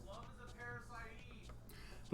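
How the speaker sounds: distant faint voice from another room; soft, muffled, as if through a wall. Pretend. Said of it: "says [name] from the other room".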